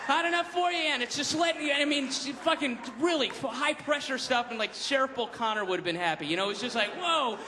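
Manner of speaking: high, rushed, breathless telling; frantic excitement. Real, not acted. Telling a friend about the circus chaos, high and frantic. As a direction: tumble out the chaos, racing.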